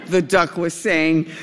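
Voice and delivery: ordinary delivery, nothing marked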